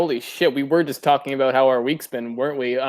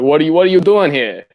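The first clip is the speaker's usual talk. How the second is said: put-on Boston accent; 6 dB louder than usual. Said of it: "Massive Southie accent".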